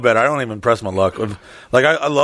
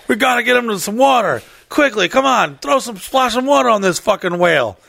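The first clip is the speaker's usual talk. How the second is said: higher-pitched frantic call for help; rally a crowd to save a whale; urgent mock rescue; 6 semitones higher than usual. Rescuers to each other over beached Shamu, mock urgent.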